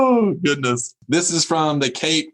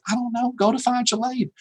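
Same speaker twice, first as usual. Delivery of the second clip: high, offhand mock shrug; dismissive brush-off; 8 semitones higher than usual. Faculty member answers a student with problems, dismissively. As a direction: imitate a faculty member fobbing off a student, pitched up. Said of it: "You're like".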